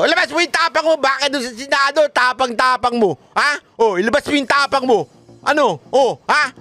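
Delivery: high sneering dare; mock taunting congressman. Congressmen daring Grijaldo, high and sneering. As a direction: goad him like a bully. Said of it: "Kay Grijaldo".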